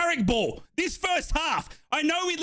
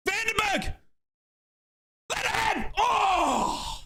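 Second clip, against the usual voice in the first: excited shout; 8 semitones higher, slower than usual. Real, not acted. Chance in attack.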